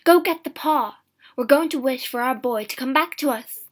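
loud, excited insistence; urgent order. A narrator's reading. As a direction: send him off with frantic hope. Wife urges husband on.

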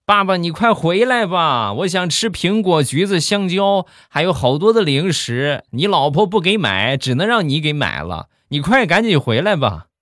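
put-on high, pleading little-kid voice; young child begging on the phone; childlike voice. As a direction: whine for treats like a small child. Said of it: "一个稚嫩的声音".

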